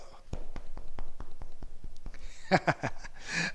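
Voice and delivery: hearty run of laughter. Real, not acted. Instructor celebrating mission accomplished with the class, laughing. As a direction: laugh along after the applause.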